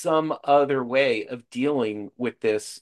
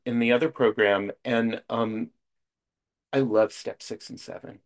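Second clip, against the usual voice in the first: ordinary delivery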